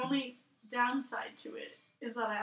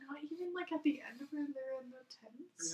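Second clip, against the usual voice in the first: hushed; 9 dB quieter than usual. Real, not acted. Hushed question.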